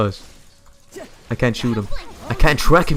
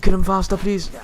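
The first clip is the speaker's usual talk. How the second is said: urgent pleading; 11 semitones higher, 4 dB louder than usual. Real, not acted. Begging team mid fight.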